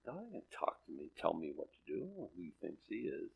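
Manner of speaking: very soft, low grumble; dropped voice, imitating a private grumble; muttered defiance. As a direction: mutter it under your breath as a sceptic. Voices the defiant unbeliever's attitude.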